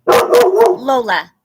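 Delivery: loud, high call across the room; calling the dog. Real, not acted. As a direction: call her name out loud to stop her. Calling the dog from her seat.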